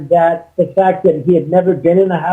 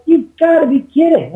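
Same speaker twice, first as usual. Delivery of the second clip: higher, disbelieving retort; mock outrage, pitched way up; incredulous; 9 semitones higher than usual.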